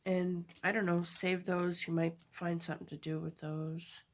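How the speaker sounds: normal talking voice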